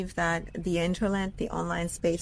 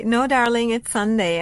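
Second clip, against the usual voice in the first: higher and louder, laughing it off; excited correction; 4 semitones higher, 6 dB louder than usual. Real, not acted. Correcting a fan who thought her birthday was today, excited. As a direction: set the viewer straight with bright energy.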